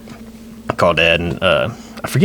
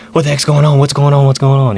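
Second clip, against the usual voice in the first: higher, louder retold panic; alarmed questioning; 6 semitones higher, 6 dB louder than usual. Young nephew answering his panicked uncle by radio, alarmed. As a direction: fire the question out repeatedly, raised and urgent.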